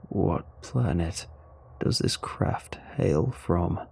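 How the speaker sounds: low, slow, soft and defeated; sad alien. A narrator's reading. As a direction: speak quietly, crushed and slow. Crushed alien speaks after a while.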